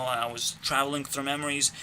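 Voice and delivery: ordinary talk, nothing marked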